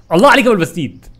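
loud, high cheer; excited cheer. Real, not acted. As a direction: cheer yourself on. Praising himself after a good move. (هتاف متحمس عالي)

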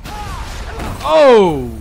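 loud, high "oh" of shock; startled shout. Real, not acted. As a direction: cry out at a sudden scare. Jump scare in game.

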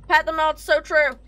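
loud shouted chant; sudden loud cheer; emphatic agreement. Real, not acted. Echoing chat with sudden shout.

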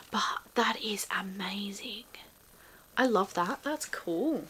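whispered in wonder; awed whisper. Real, not acted. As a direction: breathe it out, amazed. Awed by the locket.

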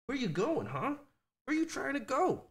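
a sing-song question to a tiny dog; puppy talk. Real, not acted. Talking to wandering puppy.

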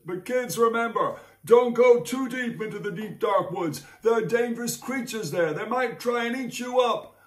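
high, anxious mother's voice; mother's warning. Acted. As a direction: warn the kids like a worried mum. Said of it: "their mother said".

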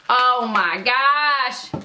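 very slow, drawn-out rapture; swooning delight. Real, not acted. Vlogger smelling scented tarts, swooning slowly. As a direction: stretch out every word in awe.

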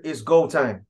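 usual speaking voice

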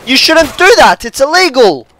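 loud, high yell of protest; shriek, then scold the shooter at full volume; indignant high shouting; outraged shouting. Real, not acted. Yells at enemy shooting them.

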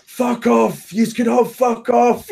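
high, stretched-out mock yell at normal volume; mock shouting. Retelling the chippy guy yelling at the group, mock shouting. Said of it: "and, like, shouting at us".

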